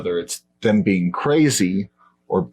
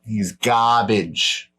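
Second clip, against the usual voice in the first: put-on lightly done Boston accent, drawn-out garbage; mock Boston tough guy, slow on garbage; a hint of Boston accent; much slower than usual. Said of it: "I'm using an Eastern, Eastern American accent".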